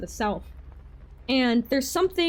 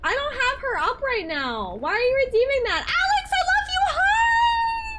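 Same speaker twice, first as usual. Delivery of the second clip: excited squeal; 14 semitones higher than usual. Real, not acted. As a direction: squeal hello to a friend. Excited greeting to chat.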